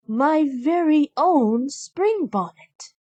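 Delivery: high, slow delighted cheer; a light, pleased cheer, drawn out. A narrator's reading. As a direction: gasp with joy at the gift. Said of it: "Cinderella cheered".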